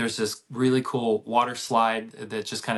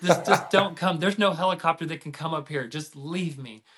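higher, hammed-up plea to be left behind; mock a teenager's big despair; dramatic teen; 6 semitones higher than usual. Dad imitating his injured thirteen-year-old, mock-dramatic plea. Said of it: "she's dramatic".

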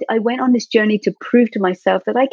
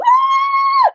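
very high-pitched squeal of release; exultant scream. Retold or imitated. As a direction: re-enact a roadside victory scream. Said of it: "Just like that".

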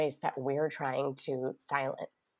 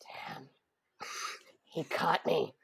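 whispered thought to self; hushed whisper of a guilty parent; whispered aside; 4 dB quieter, slower than usual. Retold or imitated. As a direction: whisper it, caught red-handed. Inner thought, caught out by son.

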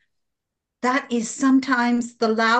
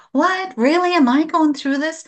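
high, louder disbelief; incredulous self-questioning; 6 semitones higher, 4 dB louder than usual. Acted. Joyful person voicing her doubting moments, high and incredulous. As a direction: question yourself in raised-pitch disbelief. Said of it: "moments when I question myself".

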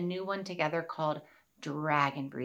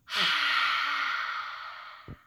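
long breathy dragon exhale; dragon breath sigh; 7 semitones higher than usual. Shown as a demonstration. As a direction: blow out like a dragon. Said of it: "breathe out our fiery breath".

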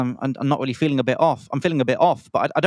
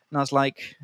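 usual speaking voice

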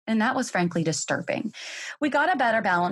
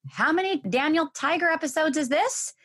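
mock yell; 9 semitones higher than usual. Mom calling to kids in another room, mock yell. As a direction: pretend to yell across the house.

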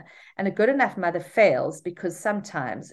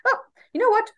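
put-on much higher, louder bright sing-song to a toddler; upbeat distraction voice; 10 semitones higher, 4 dB louder than usual. Shown as a demonstration. Mother distracting her toddler with popcorn, excited sing-song. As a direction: sell the fun plan to pull a child out of a tantrum. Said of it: "of just diffusing conflict where".

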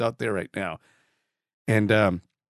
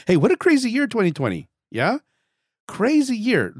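high, loud, wide-eyed exclamation; half-laughing disbelief, pitched up; exclaiming; 11 semitones higher, 5 dB louder than usual. Real, not acted. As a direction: marvel out loud at the madness of the year. Exclaiming about crazy 2020.

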